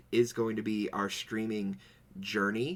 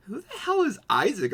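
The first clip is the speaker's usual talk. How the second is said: squeaky high incredulous voice, much higher and louder; high mock confusion; 13 semitones higher, 8 dB louder than usual. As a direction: play it as a baffled shriek. Retelling confused reaction.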